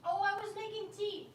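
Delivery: a sudden high-pitched "oh" of remembering; sudden realisation. Real, not acted. Streamer suddenly remembers her tea, pitched high in surprise. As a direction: pitch jumps high as it comes back to her.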